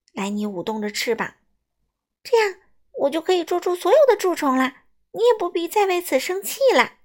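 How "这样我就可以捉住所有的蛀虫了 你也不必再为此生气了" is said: high, loud, eager voice; excited little bat. A narrator's reading. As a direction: pitch the plan with delighted energy. Little bat excitedly offers help. (又高又响，兴奋地提议)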